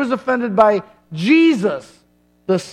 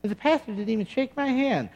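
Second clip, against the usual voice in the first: soft, sulky whiny complaint; mock offended churchgoer; 10 dB quieter than usual. Preacher mimics offended member, soft sulky whine. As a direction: pout like a hurt member.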